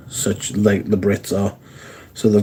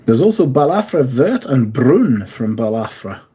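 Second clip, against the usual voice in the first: ordinary talk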